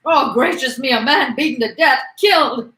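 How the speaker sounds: high, loud horrified outcry; woman's scream. Acted. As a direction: cry out as a shocked onlooker. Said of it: "screamed a woman".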